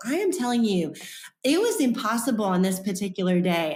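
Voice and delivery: usual speaking voice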